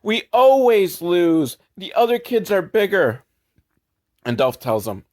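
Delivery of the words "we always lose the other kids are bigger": put-on whiny kids. Sad kids complaining to Dolph, whiny.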